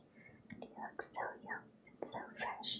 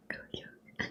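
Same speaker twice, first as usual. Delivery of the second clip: whispered cartoon chuckle attempt; Goofy; 7 semitones higher than usual. Shown as a demonstration. Reader practising Goofy's sound, whispered impression. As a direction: try the cartoon dog's catchphrase in a whisper. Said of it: "It's hard to do".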